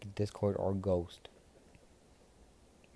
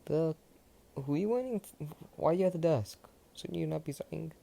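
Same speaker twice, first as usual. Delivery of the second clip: a father ribbing his daughter, voice pitched up; teasing father; 9 semitones higher than usual. Acted. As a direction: playfully needle her in a squeaky higher voice. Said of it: "Had her father teasing her".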